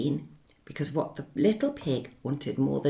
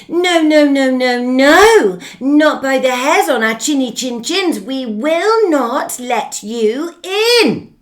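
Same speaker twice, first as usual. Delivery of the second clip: defiant refusal; 8 semitones higher, 12 dB louder than usual. Acted. Pigs refuse together.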